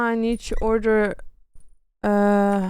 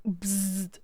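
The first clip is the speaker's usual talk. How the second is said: harsh error buzz; 9 dB quieter than usual. Pretend. Imitating game device noise.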